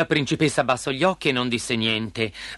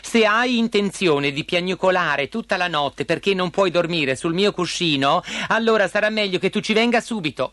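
high, fed-up princess voice; exasperated pleading; 6 semitones higher than usual. A narrator's reading. Princess letting frog up but keep away, exasperated. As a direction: give in with a huff, then plead.

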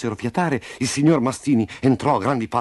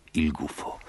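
plain reading voice

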